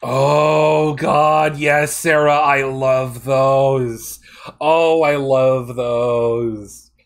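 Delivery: loud, drawn-out gush of delight; excited. Real, not acted. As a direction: gush over them, slow and loud. Excited fan reaction.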